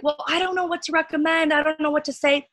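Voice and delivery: a lost newcomer unsure what to recommend; lightly voiced helpless beginner; mock confused newbie. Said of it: "you're probably like".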